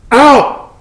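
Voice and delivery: very high and loud; enraged painter screaming at the photographer; furious yell. Acted. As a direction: scream him out the door. Pollock throwing Hans out.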